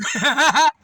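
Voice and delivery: loud high cackle; loud high laugh. Real, not acted. Narrator cracking up at his own retort, loud laugh.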